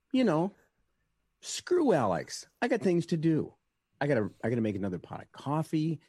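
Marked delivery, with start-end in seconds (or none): 0.1-3.5 high, loud mocking diva; sassy brush-off. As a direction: toss it off, higher and snooty. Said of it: "I'm like".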